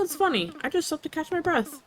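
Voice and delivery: ordinary delivery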